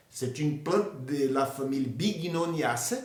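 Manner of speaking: usual speaking voice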